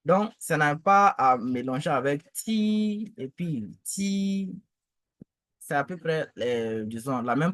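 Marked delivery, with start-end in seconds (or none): 2.3-3.1 "ti" high, long and level; sustained, unbending high "ti"; first tone. Shown as a demonstration. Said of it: "le ton". 3.8-4.5 "ti" high, long and level; sustained, unbending high "ti"; first tone. Shown as a demonstration. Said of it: "le ton".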